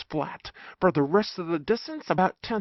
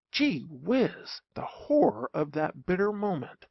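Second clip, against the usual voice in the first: ordinary delivery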